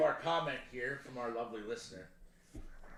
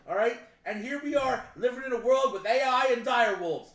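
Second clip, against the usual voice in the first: loud, high-pitched exasperated tirade; worked-up comic rant; 6 semitones higher, 6 dB louder than usual. Real, not acted. Riled co-host warning about AI and dire wolves, ranting. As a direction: hammer it out, loud and emphatic. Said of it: "All right, Dennis Leary, calm down".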